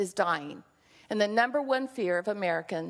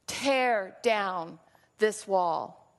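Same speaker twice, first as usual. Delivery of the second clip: very slow, deliberate challenge; a lightly voiced Reagan, drawn out; Reagan's challenge; 2 semitones lower, much slower than usual. Reagan challenging Gorbachev, a slow lightly imitated command. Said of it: "like the former president, Ronald Reagan".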